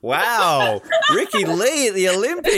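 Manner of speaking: delighted disbelief. Real, not acted. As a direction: squeal it with laughing delight. Host delighted by song choice.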